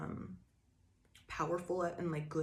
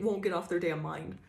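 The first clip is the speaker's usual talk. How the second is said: ordinary talk, nothing marked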